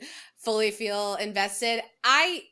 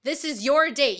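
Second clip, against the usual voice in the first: louder, slow exasperated scold; fed-up rebuke, drawn out; 5 semitones higher, 5 dB louder than usual. Real, not acted. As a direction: tell him off like he's in the room. Scolding Mike loudly.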